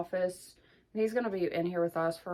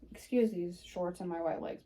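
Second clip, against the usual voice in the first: ordinary delivery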